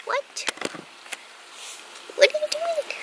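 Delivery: very high squeaky little voice; puppy voice. Retold or imitated. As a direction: voice the puppy's confusion. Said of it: "She's like".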